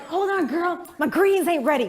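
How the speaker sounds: high mom voice brushing her off; mother cooking greens, waving her off; busy mom. Retold or imitated. Mother busy at the stove, teasingly impatient.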